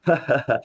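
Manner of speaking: casual open-mouthed ha-ha laugh; short demonstration of ordinary laughter; demonstrated normal laugh. Shown as a demonstration. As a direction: mimic an everyday laugh, careless of breath. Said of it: "आप ऐसे हंस रहे हो".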